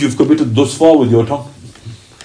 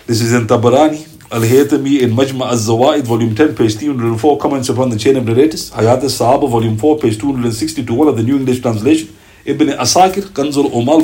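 ordinary delivery

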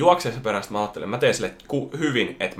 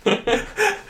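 shrill mock laugh. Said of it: "vitun pettynyt ilme".

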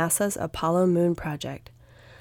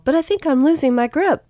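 high, raised voice calling ahead; high, louder alarm; worried call; 10 semitones higher, 6 dB louder than usual. A narrator's reading. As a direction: call out a warning as control slips. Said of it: "called Will".

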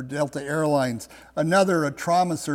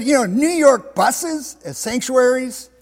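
higher, louder disbelief; incredulous question; 7 semitones higher, 6 dB louder than usual. Retold or imitated. Driver doubts the preacher's odd idea, incredulous. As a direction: question it as if it were crazy. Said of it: "looked at him like he was kinda crazy".

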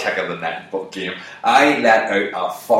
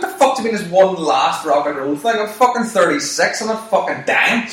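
high-pitched, outraged comeback; indignant retort; 9 semitones higher, 4 dB louder than usual. Retold or imitated. Arguing about one last rock and roll thing, indignant. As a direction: fire back in mock outrage, voice climbing.

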